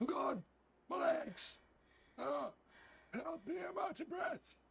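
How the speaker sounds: very high, squealed mock distress; hammed-up helpless squeal; mock panic. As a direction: wail for help in a tiny high voice. Play-acts panic running away.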